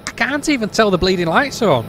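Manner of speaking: loud, high frustrated rant; fed up and shouting at the screen; exasperated outburst. Real, not acted. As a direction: blurt it out in real disbelief. Frustrated at bad train sim.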